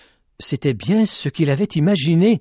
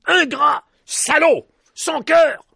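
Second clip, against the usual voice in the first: high, loud shouted insults; crowd insults; 13 semitones higher, 5 dB louder, slower than usual. A narrator's reading. Angry villagers insulting Petit Jean, loud shouts. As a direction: hurl the insults at him. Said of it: "La foule l'injuriait".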